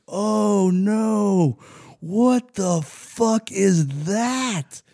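high, very slow, drawn-out mock outrage; mock drill sergeant. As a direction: stretch it out like a sergeant who can't believe his eyes. Drill sergeant finding the loofah.

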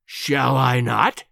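slow, low threat rising at the end; menacing challenge. Acted. Professor advancing on the journalist, slow and menacing. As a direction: draw out the question menacingly.

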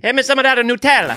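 high cartoon Italian; hammed-up Italian accent. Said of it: "we're back in Italy".

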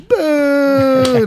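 long, high, loud boo; drawn-out jeering sound effect; imitated crowd boo. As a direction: hold the boo like a heckling crowd. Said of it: "sound effects of the fucking".

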